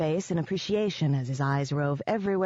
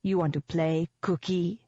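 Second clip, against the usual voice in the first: put-on thick Hollows accent. A narrator's reading. Menacing the man beneath her, thick Hollows accent. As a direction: taunt in a Hollows accent, rising at the end. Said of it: "I breathed in a thick Hollows accent".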